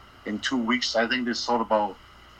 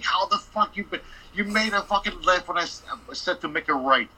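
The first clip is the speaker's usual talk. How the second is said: higher, exasperated scolding; pissed off; 6 semitones higher than usual. Retold or imitated. Narrator scolding the panicked driver for a wrong turn, angry. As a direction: vent at your friend for the wrong turn.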